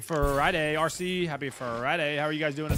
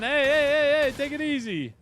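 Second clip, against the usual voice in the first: higher, louder call to calm down; loud hey; 10 semitones higher, 8 dB louder than usual. Real, not acted. Streamer loudly telling a teammate to take it easy. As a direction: shout them down, take it easy. Calming an overexcited moment.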